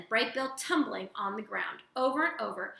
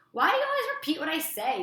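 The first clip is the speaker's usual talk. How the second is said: annoyed; 7 semitones higher, 5 dB louder, faster than usual. A narrator's reading. Annoyed sister bear.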